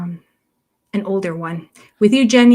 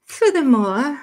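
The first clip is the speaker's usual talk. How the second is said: put-on posh British accent, higher pitch; 7 semitones higher, slower than usual. Vlogger acting out a commenter's show title, posh accent. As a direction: say it in a plummy posh English accent. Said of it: "say it with a posh accent".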